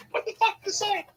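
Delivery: high, disbelieving challenge; imitating the startled dude; mock indignant guy. Imitating the dude's indignant reply.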